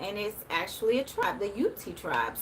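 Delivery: ordinary talk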